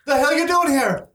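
high-pitched indignant challenge; irate cave woman. Acted. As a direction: challenge them as an angry cave dweller. Irate woman greets intruders in story.

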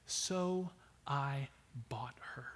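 slow, hushed whisper. Real, not acted. Hosea telling how he bought Gomer back, whispered. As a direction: drop to a breath on the redemption.

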